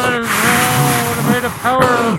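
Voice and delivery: slow loud recreation with wind noise; drawn out, half-drowned in wind; windy distorted voice. Retold or imitated. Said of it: "we'll recreate".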